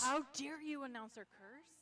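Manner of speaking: playful loud how-dare-you; mock outrage. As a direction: act offended, loudly.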